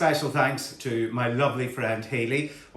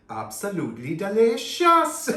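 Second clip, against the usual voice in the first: put-on Welsh accent; 9 semitones higher, 5 dB louder than usual. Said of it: "I apologise for the terrible Welsh accent".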